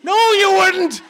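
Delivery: very high, very loud explosive contradiction; screaming cop. Retold or imitated. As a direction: bellow it back in disbelief, imitating a furious cop. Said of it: "starts screaming all this psycho stuff".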